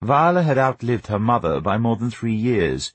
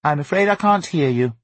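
high, lilting feigned deafness; sing-song refusal to listen; Viola; 7 semitones higher than usual. A narrator's reading. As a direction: breezily pretend not to hear the child. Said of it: "Viola said".

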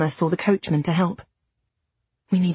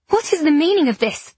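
higher, louder indignant demand; 8 semitones higher, 4 dB louder than usual. A narrator's reading. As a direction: confront them indignantly. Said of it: "I demanded".

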